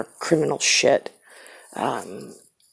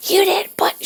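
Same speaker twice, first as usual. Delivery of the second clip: high, loud shriek of an unhinged mother; 6 dB louder than usual. Retold or imitated. Mother scolding about the baby, high loud mimicry. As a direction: mimic the scary mother bursting in. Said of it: "it was like [name]".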